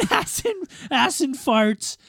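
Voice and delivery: put-on high, slow, laughing drunk voice; imitating drunk friend. Retelling a drunk friend's call, high and laughing. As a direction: ham up the drunk buddy's joke. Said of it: "then he's like".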